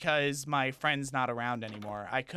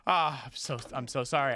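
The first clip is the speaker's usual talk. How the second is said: ordinary talk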